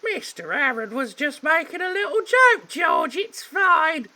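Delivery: high, loud falsetto maid. Acted. Maid calming the butler, falsetto. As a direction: chirp it out sweetly. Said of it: "apparently I will for her".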